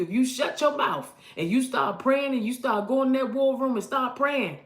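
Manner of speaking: normal talking voice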